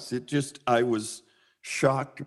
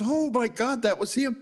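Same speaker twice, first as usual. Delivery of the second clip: high-pitched retold astonishment; amazed realization; 9 semitones higher than usual. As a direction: relive the moment it dawned on you. Realizing who the man was.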